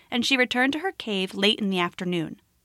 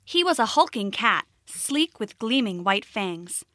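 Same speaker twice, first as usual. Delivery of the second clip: plain reading voice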